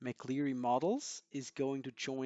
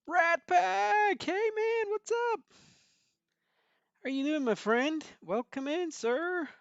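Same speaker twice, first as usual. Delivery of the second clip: much higher, loud excited welcome; excited greeting; 7 dB louder than usual. Real, not acted. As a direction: squeal a delighted hello to a friend. Greets viewer Rat Pack on stream.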